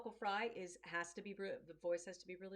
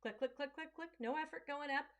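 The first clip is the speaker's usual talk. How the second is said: clicking coaster sound; 7 semitones higher, 4 dB louder than usual. Shown as a demonstration. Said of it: "roller coaster click sound".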